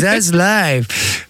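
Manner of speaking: drawn-out slurred English; slow drunk imitation. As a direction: stretch it slowly like a drunk. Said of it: "qui parle anglais comme ça".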